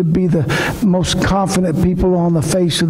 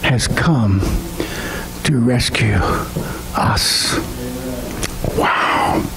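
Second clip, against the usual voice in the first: lower, softer, voice breaking with emotion; overcome, barely getting the words out; choked up; 4 semitones lower, 3 dB quieter, slower than usual. Real, not acted. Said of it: "I'm sorry I got so emotional".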